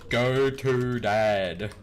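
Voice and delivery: loud, very drawn-out command; laboured, loud spelling-it-out delivery; exasperated repeat. Real, not acted. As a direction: stretch every word out loudly. Repeats command the game rejected.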